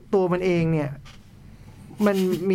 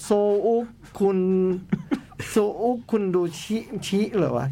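syllable by syllable with long pauses; halting sounding-out. Real, not acted. Host puzzling out a long Korean title, halting sounding-out. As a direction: struggle to read out an unreadable title. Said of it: "โอ้ จะอ่านว่าอะไรวะ?".

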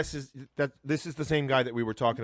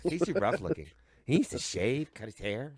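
grandmother voice; 3 semitones lower, 5 dB quieter, slower than usual. Retold or imitated. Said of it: "That's my grandmother".

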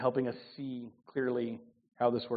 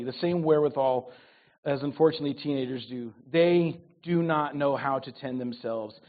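normal talking voice

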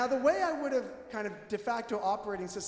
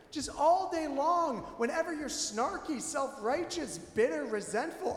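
ordinary talk

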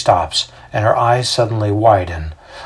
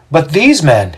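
public accuser; 6 semitones higher, 4 dB louder than usual. A narrator's reading. Xander's accusation before magistrates.

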